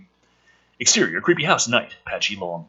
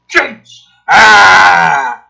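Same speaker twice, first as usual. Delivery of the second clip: higher, louder startled cry; mock panicked yell; 6 semitones higher, 14 dB louder than usual. Kid yelps when the cop pulls a gun. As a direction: yelp in fright and bolt. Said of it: "the kid was like".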